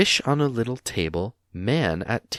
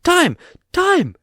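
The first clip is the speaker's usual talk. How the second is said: very high, loud squeak; sudden squeal; 9 dB louder than usual. A narrator's reading. Bilbo squeals at Gollum in terror. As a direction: let it burst out as a squeal. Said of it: "with a sudden squeal".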